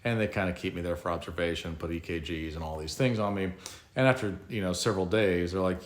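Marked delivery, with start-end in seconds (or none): none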